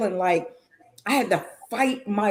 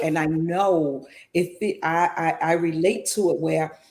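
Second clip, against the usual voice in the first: ordinary talk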